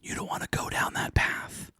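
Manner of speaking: hushed, secretive whisper; whispering mouse. Acted. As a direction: whisper a warning into her ear. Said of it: "the mouse is whispering to her".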